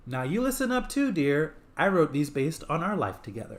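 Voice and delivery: high put-on woman voice; female voice. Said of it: "Simon says in a female voice".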